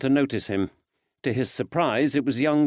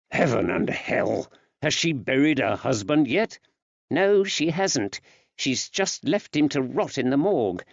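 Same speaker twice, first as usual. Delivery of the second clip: woman's voice; 6 semitones higher than usual. Acted. Betsy worried report.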